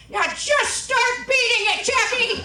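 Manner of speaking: slow, high, loud command; imitated grandma bellowing, words stretched out; mock yelled order. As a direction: holler it as an impatient grandma. Grandma ordering her to beat possum.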